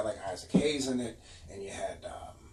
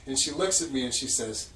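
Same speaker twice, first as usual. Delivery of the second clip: normal talking voice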